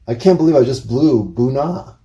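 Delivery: normal talking voice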